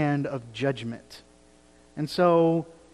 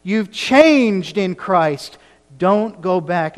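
loud, higher, slow pleading; begging plea; 4 semitones higher, 9 dB louder, slower than usual. Retold or imitated. Peter begging the church not to return to sin, loud and slow. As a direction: beg them not to go back, with all your heart. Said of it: "And he's begging them".

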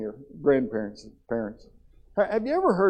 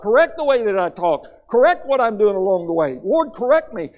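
higher and louder fervent plea, building on each correct; crying out to God; 8 semitones higher, 7 dB louder than usual. Shown as a demonstration. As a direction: pour it out like a desperate prayer. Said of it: "We need to cry out something like".

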